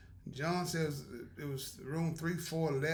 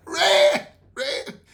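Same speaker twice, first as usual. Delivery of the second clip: laughing; 4 semitones higher, 16 dB louder than usual. Retold or imitated. Repeating her warning while laughing.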